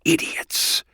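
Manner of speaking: voiceless hissed word; hissed silently. Acted. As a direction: hiss it out with no voice at all. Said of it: "he hissed the words silently through his lips".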